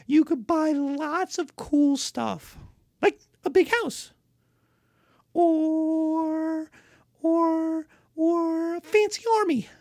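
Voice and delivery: high, loud, quick persuading; a lord hurriedly selling taxes to barbarians; eager lord pitch. Acted.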